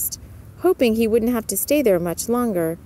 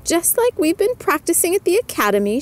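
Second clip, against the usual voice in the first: higher-pitched encouragement to pupils; higher voice of a motherly witch; good witch teacher; 8 semitones higher than usual. A narrator's reading. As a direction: coach the girls in a lifted voice. Good witch coaching her pupils.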